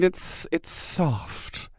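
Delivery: hushed, very slow and drawn out; soft. Shown as a demonstration. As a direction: let the word soft come out softly. Softly describing the pronunciation.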